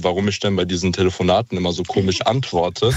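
ordinary delivery

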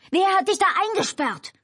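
very high yelled demand; frantic high yell; shouting. A narrator's reading. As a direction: shout it with the pitch shooting up. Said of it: "schrie Albert".

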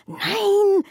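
hissed no. A narrator's reading. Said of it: "zischt Zora".

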